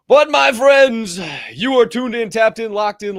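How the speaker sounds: loud, high host's opening call; rousing live-show intro; show announcer. Real, not acted. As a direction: announce it big, stretching the first words. Launches the show's formal intro.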